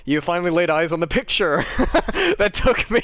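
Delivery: high, laughing excited brag. Real, not acted. Artist telling player about the six-day drawing, laughing excitedly. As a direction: giggle through the proud reveal.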